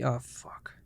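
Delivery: breathy hushed curse under the breath; whispered curse. Real, not acted. He fumbles something while talking to viewers, whispering. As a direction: let a curse slip out in a whisper. Slip mid ASMR talk.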